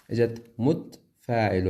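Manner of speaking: متفاعل said mutfaa'il, the ta dropped to a stop; مُتْفاعل with silent ta. Shown as a demonstration. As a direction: clip the ta in متفاعل. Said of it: "اللي هي بتسكين التاء". (متفاعل بتسكين التاء)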